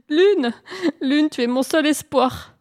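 high, wailing call; pleading. Acted. Hare calls the moon his only hope, pleading. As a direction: implore in a put-on pitiful voice.

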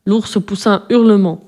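ordinary delivery, nothing marked

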